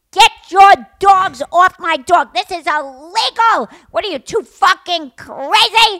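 slow, high, furious retold shout; screaming dog owner. Man screaming at two women over their dogs, furious shout. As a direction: yell each phrase in outrage. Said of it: "as he's screaming at us".

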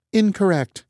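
high, curt machine verdict; computer voice. Acted. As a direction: reject it like a robot. Computer rejects answer.

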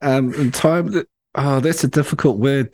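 ordinary delivery

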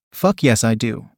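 plain reading voice

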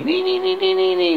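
high, repeated squeal of Ni; shrieking Ni. Acted. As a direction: screech it out like the Knights who say Ni. Knights shout Ni at Arthur.

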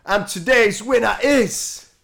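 big game show host build-up; announcer voice. Pretend.

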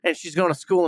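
ordinary delivery

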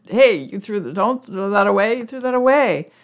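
high, indignant protest at the partner; upset. Pretend. Speaker confronting partner about trashed item, high indignant protest. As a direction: sound put out and indignant, pitched well up. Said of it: "and I was upset".

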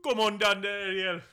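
hammed-up Jamaican accent calling across the water. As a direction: call to Ariel in a Jamaican-accented crab voice. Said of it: "I'll do a Jamaican accent right now".

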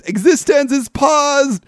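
high, drawn-out dramatic yell; mock movie-hero scream; retold scream. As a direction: cry it out slowly, pitch way up. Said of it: "stands up and screams".

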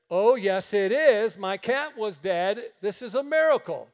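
loud, high, insisting it's a miracle; insistent shout. Retold or imitated. Woman insisting to the preacher, loud. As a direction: shout the miracle back at him.

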